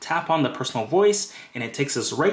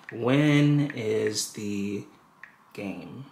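slow dictation; 3 semitones lower, much slower than usual. Real, not acted. Speaking words slowly while typing.

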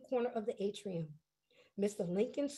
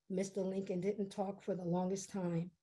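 ordinary delivery

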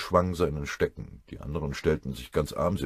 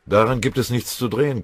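louder, higher, gruff refusal; growled; 7 semitones higher, 8 dB louder than usual. A narrator's reading. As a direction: growl it out firmly and loudly. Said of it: "knurrte Beutelschläger". (laut geknurrt)

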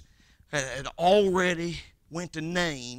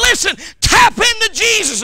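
preacher's shouted plea; 14 dB louder than usual. Retold or imitated. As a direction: shout it high and loud. Preacher quoting his own advice.

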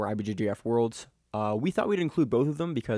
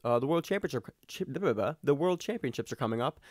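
ordinary talk

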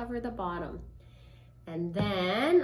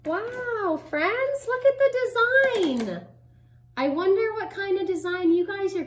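high, loud excited wow; 10 semitones higher, 8 dB louder than usual. Real, not acted. Excited reveal of the painting.

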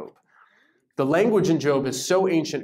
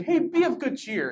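high, breezy mock greeting; cheery greeting; 9 semitones higher than usual. Being walking on water greets terrified men, cheery and high. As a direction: call out a casual, high-pitched hello.

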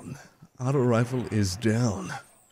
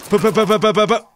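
mouth gunfire; 8 semitones higher, 5 dB louder, faster than usual. Real, not acted.

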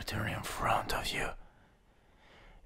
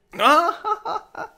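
shrieking evil laugh; 11 dB louder than usual. Acted. Demon laughing at pain.

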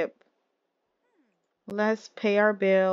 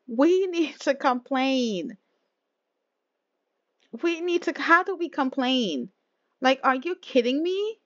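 higher-pitched, affronted complaint; indignant disbelief; 5 semitones higher than usual. Real, not acted. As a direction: protest as if you can't believe it. Indignant at the dropped food.

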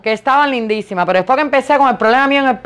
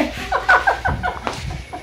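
breathless high-pitched giggle; high airy giggle; 8 dB quieter than usual. Real, not acted. Laughing at her own limp joke, high airy giggle. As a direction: let a squeaky laugh escape.

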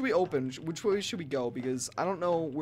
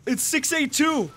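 ordinary delivery

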